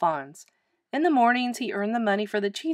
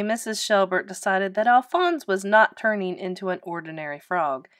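ordinary delivery, nothing marked

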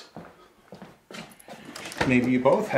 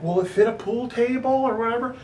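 mock family member asking; 10 semitones higher than usual. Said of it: "It's like".

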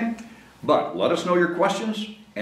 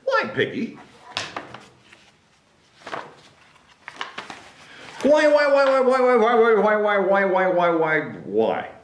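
wailing Gerald; 6 semitones higher than usual. Acted. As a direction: wail it, then let it sink.

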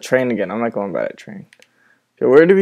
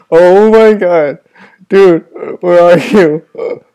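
scared; 4 semitones higher, 7 dB louder, much slower than usual. Real, not acted. Lost friend in scary game.

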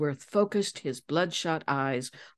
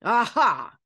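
loud, higher burst of discovery; exclaimed; 6 semitones higher, 5 dB louder than usual. A narrator's reading. King Darius snaps his fingers at a realisation, exclaiming. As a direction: cry out as it dawns on you. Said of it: "he exclaimed".

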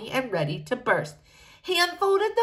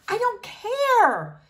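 slow, higher, drawn-out indifference; dismissive princess; 12 semitones higher, much slower than usual. A narrator's reading. Princess brushes off Ruby LaRue, slow and dismissive. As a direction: stretch it out to show you don't care.

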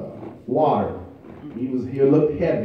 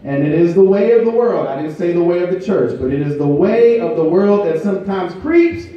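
high, loud, rhythmic preaching with held notes; full-force preaching; 6 semitones higher, 6 dB louder than usual. Acted. Pastor urging the congregation to say thank you, preaching at full force. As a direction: build it up and drive it home to the church.

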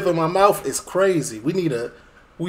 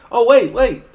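sudden surprise; 6 semitones higher, 8 dB louder, slower than usual. Real, not acted. Sudden reaction to the video.

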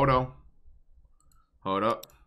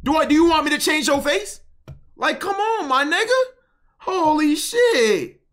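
high-pitched, loud exasperated whine; worked-up protest in a high, strained yell; exasperated rant; 13 semitones higher, 4 dB louder than usual. Real, not acted. Ranting at chat's screenshot complaint.

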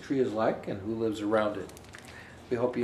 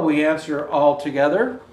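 bright, lilting storybook voice; gentle animated read-aloud; reading to children; 5 semitones higher, 7 dB louder than usual. Acted. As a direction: read it like a picture book to little kids. Reads picture book aloud.

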